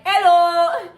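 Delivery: high, loud attempt at a British hello; bad British accent. Shown as a demonstration. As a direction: put on a hammy British accent. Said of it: "doing a British accent".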